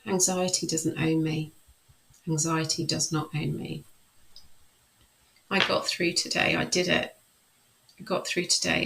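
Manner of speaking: calm, measured self-talk while tapping. Shown as a demonstration. Coach demonstrating tapping, calm affirmation voice. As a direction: recite the tapping phrases steadily, soothing yourself. EFT tapping demo script.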